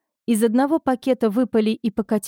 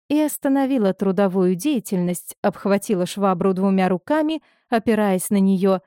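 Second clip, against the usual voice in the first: ordinary delivery, nothing marked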